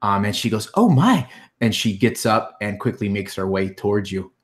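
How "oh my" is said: high, loud shocked "oh my"; sudden high alarmed exclamation; shocked gasp. Acted. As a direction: gasp at the sight of the wounded girl. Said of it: "she sees Lily beyond you".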